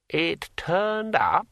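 put-on an old dead man querying word by word; drawn-out, rising old-man question; brittle old voice. A narrator's reading. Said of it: "in a brittle old voice".

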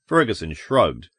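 ordinary delivery, nothing marked

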